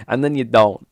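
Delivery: normal talking voice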